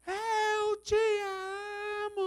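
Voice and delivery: slow, high, stretched-out shout; shouted declaration. Retold or imitated. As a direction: cry it out as ordered.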